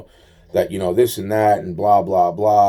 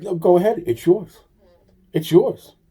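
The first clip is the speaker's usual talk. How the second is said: higher, coaxing mock offer; retelling the man pushing the money on him, pitched up; insistent offer; 7 semitones higher than usual. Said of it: "He's like".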